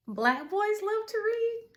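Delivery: high, rising question that climbs to READ; questioning echo. Acted. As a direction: lift the pitch steadily up to "read", as if asking. Echoes society's question to the boys.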